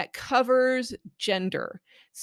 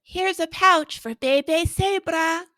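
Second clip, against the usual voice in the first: storybook reading voice; 9 semitones higher, 6 dB louder than usual. Acted. Narrator line.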